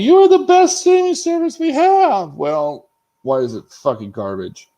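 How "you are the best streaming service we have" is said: high, loud mock customer-service voice; mocking sales pitch. Streamer quoting the internet company's boast, mocking high voice.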